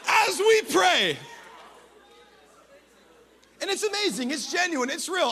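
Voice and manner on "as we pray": high, drawn-out shouted prayer; booming prayer. Acted. Man praying aloud before church, high impassioned shout. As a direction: cry it out loud and high, stretching the last words. Acting out a loud public prayer.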